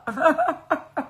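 a short bright laugh; real burst of laughter. Real, not acted. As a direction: laugh at your own joke. Laughing at her own joke.

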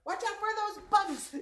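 high, loud mock warning; playful alarm. Teacher warning children about pretend bugs, playful high alarm. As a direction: warn the kids in pretend panic.

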